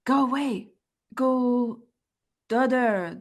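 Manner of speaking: a stern order to go away; commanding. Pretend. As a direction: point and tell it to leave. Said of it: "player voices command to creature".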